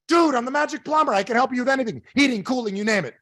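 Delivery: high-pitched, boastful acted plumber; insistent plumber. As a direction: sell your services with exasperated confidence. Plumber boasting to doubting customer.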